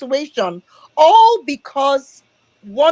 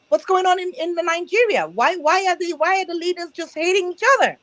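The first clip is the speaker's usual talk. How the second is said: lightly voiced American friend, higher and puzzled; quoting puzzled American friend; 6 semitones higher than usual. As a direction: echo the friend's baffled question, pitched up. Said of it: "asked my husband that".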